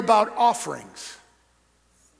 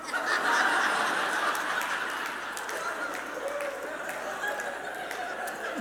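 breathless high laughing; airy high laugh; 8 dB quieter than usual. Real, not acted. Preacher cracking up at his mom's joke, airy high laugh.

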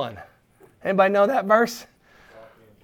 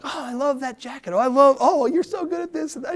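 high-pitched mock gushing; shower compliments in a high voice; gushing encourager; 8 semitones higher than usual. Encourager greeting someone entering the room, gushing. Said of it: "they're always encouraging you".

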